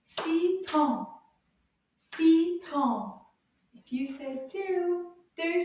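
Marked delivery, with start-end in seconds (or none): 0.0-3.2 "Citron" in two loud, separate beats; syllable by syllable. Shown as a demonstration. Teacher asks how many syllables, "Citron" loudly beaten out. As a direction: punch out each syllable of "Citron". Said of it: "Combien de syllabes dans le mot citron?".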